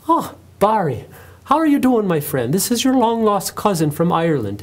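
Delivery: high, lilting mock Irish brogue; horrible fake Irish accent. Waiter teasing Irish coworker Barry, horrible fake Irish accent. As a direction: hail him loudly in a hammed-up Irish accent. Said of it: "that horrible fake Irish accent".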